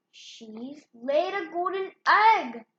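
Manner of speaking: ordinary delivery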